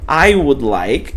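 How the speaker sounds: I would like, each word spaced out slowly; slow, careful I would like; slow English demo. Shown as a demonstration. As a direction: spell out I would like slowly for learners. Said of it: "vou pedir, ó".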